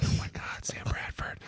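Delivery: breathless awed whisper; starstruck whisper. Retold or imitated. Player starstruck as Sam Bradford walks up, awed whisper. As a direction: whisper it like you can't believe he's standing there.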